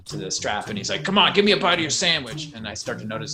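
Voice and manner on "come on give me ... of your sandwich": put-on louder and higher, pushy drunken begging; pushy drunk guy. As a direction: play the drunk stranger nagging for food. Drunk man pestering on subway.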